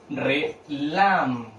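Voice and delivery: "Relámpago" very slowly, syllable by syllable, long "lam"; sílaba por sílaba. Shown as a demonstration. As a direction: break "Relámpago" into drawn-out, separated syllables. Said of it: "¿Dónde cargo la voz?". («Relámpago» muy lento, separado en sílabas, alargando «lam»)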